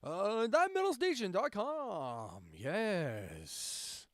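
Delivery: stretched-out mock station plug; radio voice. Shown as a demonstration. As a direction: do a hammed-up radio announcer. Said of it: "do my radio voice for you".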